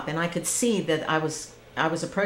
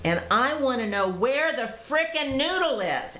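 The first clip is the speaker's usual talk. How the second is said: higher, strained mock yell of anger; frustrated inner scream; 9 semitones higher than usual. Drowning woman raging at God, mock yell. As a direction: mildly act out fury at God. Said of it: "I'm screaming this to myself".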